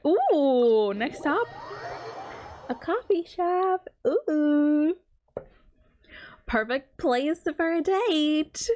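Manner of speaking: teasing date tease. Real, not acted. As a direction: drawl it out like a matchmaker teasing a friend. Teasing about a game date.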